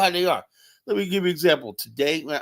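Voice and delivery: normal talking voice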